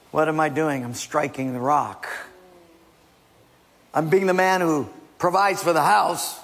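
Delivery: ordinary delivery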